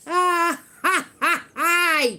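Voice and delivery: high, loud jeering cackle; mocking laugh. Real, not acted. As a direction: cackle at the haters. Laughing at the haters.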